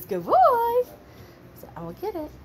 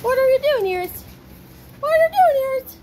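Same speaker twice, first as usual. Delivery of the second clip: high, loud call to the puppy; excited high call; 12 semitones higher, 6 dB louder than usual. Real, not acted. Owner calling to her puppy, high and excited. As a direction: sing out her name, delighted.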